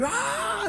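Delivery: very high imitated squeal of joy; joyful cry. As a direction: act out a crowd's delight at a healing. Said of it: "La alegría".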